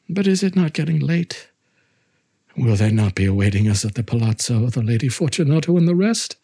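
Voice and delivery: anxious plea. Acted. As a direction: beg to leave, rising panic. Fortunato pleads to leave.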